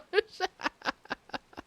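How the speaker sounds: long high-pitched laugh; cracking up at chat. Real, not acted. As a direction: let the laugh ring out high.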